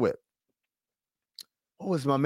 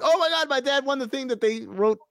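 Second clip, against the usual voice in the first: mock excited kid; 9 semitones higher than usual.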